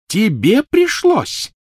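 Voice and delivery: high, very slow mocking echo; scornful. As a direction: throw his word back in scorn. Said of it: "Голос у Гермионы всё повышался".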